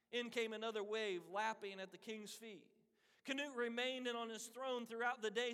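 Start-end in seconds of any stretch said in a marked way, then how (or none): none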